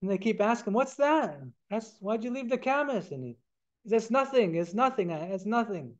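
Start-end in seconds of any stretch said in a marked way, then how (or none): 0.7-3.1 pestering. Acted. Women pestering him with questions. 4.1-5.9 flustered denial. Acted. Deflecting the women's questions.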